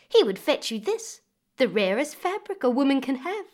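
high, loud, gushing praise; enticing. Acted. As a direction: sell the dream of the cloth, bright and eager. Woman praising the serpent cloth.

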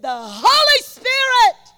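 high, slow, loud preacher intonation; imitating Brother Norval. Preacher mimicking Norval's lofty announcement. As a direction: stretch it out like a proclamation. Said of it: "Brother Norval said".

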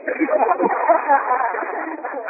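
high bright laugh; hearty laugh. Real, not acted. Prophet laughing about bling bling for wife. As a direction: laugh with joy at the thought.

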